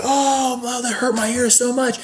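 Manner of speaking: a sarcastic pained whine; whiny mock guest voice. Guest whining after the whistle.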